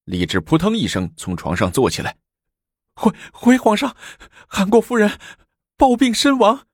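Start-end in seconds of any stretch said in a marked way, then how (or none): 3.0-6.7 much higher, breaking, stuttering servant; panicked stammer. Acted. Servant bursting in on the emperor, panicked stammering. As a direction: gasp out the news in terror. Servant announcing sudden death.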